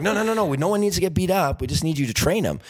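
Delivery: mock-panicked producers backpedalling; hasty correction. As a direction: rush to calm him down. Producers hurriedly correcting Danny.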